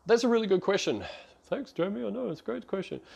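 playful pitch jump, answering an imaginary co-host; mock co-host banter. Pretends a co-host asked him.

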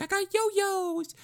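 very high, squeaky cartoon voice; hammed-up squeaky little brat; Rinku. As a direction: shoot up into a tiny falsetto taunt. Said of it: "pops out and he's like".

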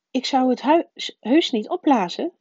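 put-on protesting boy. A narrator's reading. Harry assures his aunt he'd behave, protesting.

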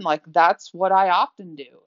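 usual speaking voice

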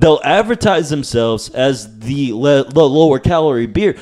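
hammed-up beer ad pitch; mock ad read. Hosts jokingly plugging their sponsor, mock ad read. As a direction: sell it like a beer commercial.

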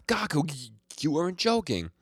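loud, high-pitched stammered exclamation; mock shocked outcry. Host voices Azuki calling to Gaku, mock shocked outcry. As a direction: cry out in mock disbelief, tripping on the words.

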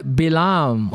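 Balaam as "bee-LAHM", two syllables. Shown as a demonstration. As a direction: say Balaam with a short "bi" then long "lahm".

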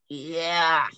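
a lightly voiced ghostly yeah, slow and falling; hint of a possessed growl; scary yeah. Retelling the spirit answering the investigator, a lightly scary yeah. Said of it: "this scary "yeah".

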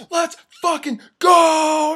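high, drawn-out celebratory scream; amped-up full-voice yell; screaming hype. Retold or imitated. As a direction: re-enact screaming your head off at the screen. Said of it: "I was screaming".

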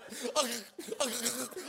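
mock blubbering sobs; imitated ugly cry. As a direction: imitate jiggly, choking crying. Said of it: "like, ugly cry, like, kissed a lemon".

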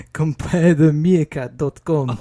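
light, slow put-on Russian accent; a hint of the meerkat's Russian drawl; Aleksandr Orlov voice. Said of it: "is he a Russian character?".

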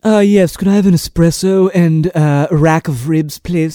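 mock posh order. Ordering at odd cafe.

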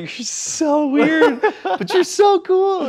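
very high, slow, laughing exclamation; amused amazement. Retold or imitated. Teller reacts to the weirdest woman he met, laughing amazement. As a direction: squeal it slowly through laughter.

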